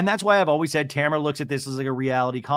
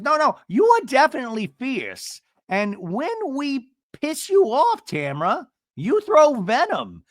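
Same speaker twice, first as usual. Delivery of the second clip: high-pitched, drawn-out impression; high mock confronting voice; imitated castmate confrontation; 9 semitones higher than usual. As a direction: mimic a castmate laying it on slowly. Acts out castmate confronting Tamra.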